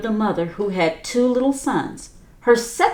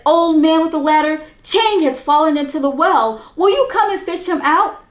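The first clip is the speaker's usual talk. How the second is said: urgent plea; 7 semitones higher, 4 dB louder than usual. Acted. Boy begs old man.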